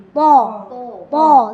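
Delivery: "Po" drawn out as a heavy emphatic T with round O; thick TO. Shown as a demonstration. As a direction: thicken "Po" until it sounds full and dark. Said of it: "sampai tebal ya".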